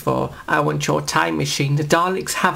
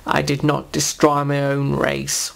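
ordinary delivery

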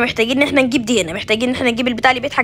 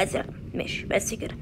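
whisper; 4 semitones lower, 15 dB quieter than usual. Real, not acted. Quiet aside while playing.

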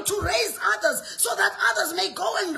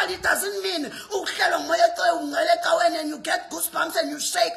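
ordinary talk

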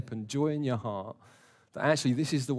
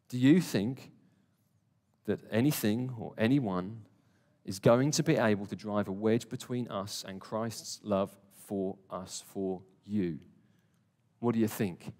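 quieter, spaced out with long pauses; deliberate, searching question; 5 semitones lower, 4 dB quieter than usual. Shown as a demonstration. Preacher puts the great question to each listener, deliberate and searching. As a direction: let each phrase land, dropping low on "for you". Repeats question slowly, personally, to congregation.

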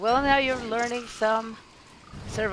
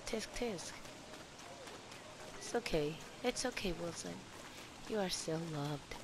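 soft soothing; 12 dB quieter than usual. Pretend. Consoling his character.

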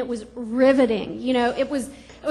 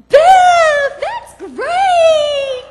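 shouting mom, much higher and louder; excited mom; 13 semitones higher, 13 dB louder, much slower than usual. Retold or imitated. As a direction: mom yelling with glee over the news. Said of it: "she got real excited".

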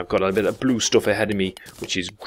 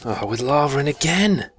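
dismayed surprise; 7 semitones higher than usual. Real, not acted. Finds lava in the cave.

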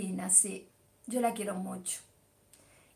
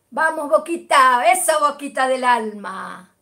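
fan cheer; 10 dB louder than usual. Real, not acted. Cheering her beloved club to viewers, loud. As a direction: chant it like a fan in the stands. Football chant for Boca.

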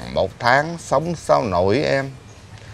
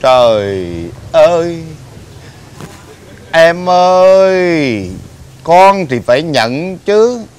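slow, loud drawn-out "em ơi"; wailing plea; 4 semitones higher, 6 dB louder than usual. Acted. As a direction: stretch the cry out to her. Husband wails back at wife.

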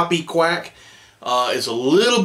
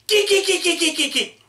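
high choppy squeaky geek bursts; rapid high-pitched duck imitation; teal hen call. Shown as a demonstration. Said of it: "Sounds a little something like this".

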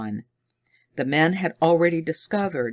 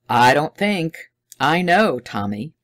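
confident; 6 dB louder than usual. A narrator's reading. Dodd certain.

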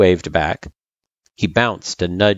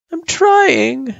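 high, loud whine; whining; 4 dB louder than usual. A narrator's reading. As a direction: whine it in a raised pitch. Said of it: "she whined".

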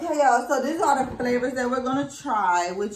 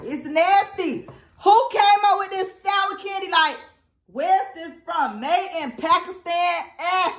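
disgusted rant; 9 semitones higher, 5 dB louder than usual. Real, not acted. Ranting about sour candy.

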